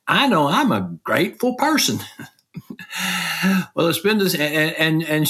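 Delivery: a light Southern Tennessee take on her line; Tennessee accent imitation. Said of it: "in that Tennessee accent that she has".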